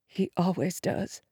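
very soft, reverent mother's voice; hushed motherly assurance. Acted. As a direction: say it softly, with quiet faith. Shaken mother speaks of prayer.

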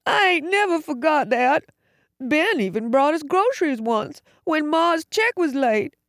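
put-on high-pitched, childlike tearful voice; upset, high, countrified lament. A narrator's reading. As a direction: plead through tears like an overgrown child. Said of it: "Tears ran down his face".

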